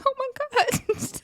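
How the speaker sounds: very high, thin, slow wail; mock crying. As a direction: imitate someone tearfully overwhelmed, hammed up. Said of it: "Lex starts crying".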